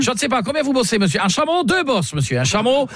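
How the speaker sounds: hammed-up snooty client lecturing the hotel clerk; mock-offended caller. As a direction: scold him like a snooty know-it-all.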